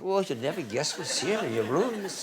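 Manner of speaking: put-on failed Scottish accent, laughing; a bad Scottish accent attempt. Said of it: "I can't do a Scottish accent".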